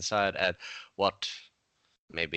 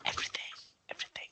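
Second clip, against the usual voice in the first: whispered; 11 dB quieter, much faster than usual. Acted. Answering what elven eyes see.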